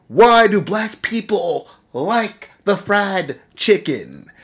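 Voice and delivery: mocking slow drawl. Mock stereotype question.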